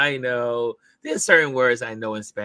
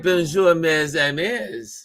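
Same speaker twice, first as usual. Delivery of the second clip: retold French greeting; 6 semitones higher, slower than usual. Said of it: "But she said".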